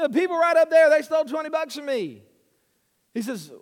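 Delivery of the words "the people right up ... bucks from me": raised, high voice of an indignant complainer; retold mad outburst. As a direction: imitate an angry robbery victim pointing out culprits. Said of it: "She's really mad".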